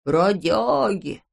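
slow, put-on rough voice; gruff voice. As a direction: growl it out like a tramp. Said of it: "ответила грубым голосом". (грубым голосом)

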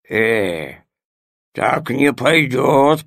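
slow, grumbling loaf; grumbling bread. Acted. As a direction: grumble it, unwilling. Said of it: "проворчал хлеб". (ворчливый хлеб)

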